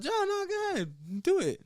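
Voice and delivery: high mock voice. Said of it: "Y'all said".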